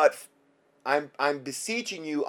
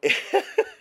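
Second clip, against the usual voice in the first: high joyful laugh; 3 dB louder than usual. Real, not acted. Overjoyed at the thought.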